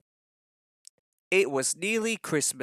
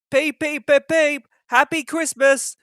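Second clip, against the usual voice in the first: much higher, louder whistle-like call; whistling engines; 11 semitones higher, 8 dB louder than usual. A narrator's reading. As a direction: toot it out like cheerful engines. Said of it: "whistled the engines".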